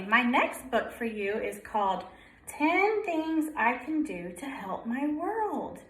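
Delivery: ordinary delivery, nothing marked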